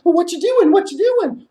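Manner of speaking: very high bat voice; squeaky bat. Acted. Bat flying down calling to the storyteller in a high squeak. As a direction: chirp it like a small bat.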